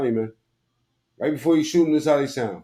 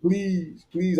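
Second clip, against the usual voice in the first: mock plea; 6 semitones higher than usual. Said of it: "my favorite part of the video".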